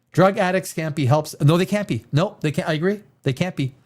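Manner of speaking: ordinary talk, nothing marked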